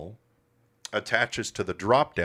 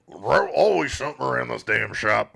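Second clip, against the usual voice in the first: louder, higher grumbling complaint; mock-grumpy rant; 4 semitones higher, 5 dB louder than usual. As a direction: gripe like a grumpy old man. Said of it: "Why do I sound so grumpy?".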